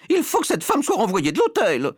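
high, loud, put-on lady's voice; scandalised high-voiced order; indignant wife. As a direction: demand it way up in pitch. Outraged wife demands expulsion.

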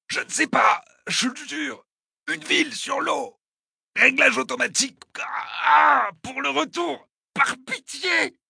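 very high, strained cries; agonized pleading. Acted. Wounded attacker begging his torturer for mercy, in agony. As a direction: swear and beg through the pain.

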